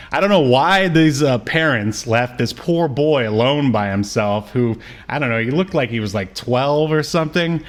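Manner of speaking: normal talking voice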